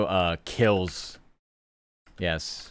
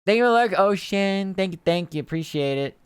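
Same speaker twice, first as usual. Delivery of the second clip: much higher, louder sing-song gratitude; cutesy high thanks; 12 semitones higher, 5 dB louder than usual. Pretend. Streamer thanking viewer Ocean, high cutesy gushing voice. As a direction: gush thanks in a squeaky voice.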